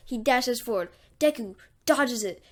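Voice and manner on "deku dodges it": ordinary delivery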